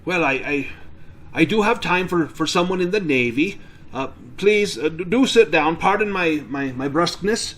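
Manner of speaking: gruffly apologetic farmer. Acted. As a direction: stammer out a brusque man's apology.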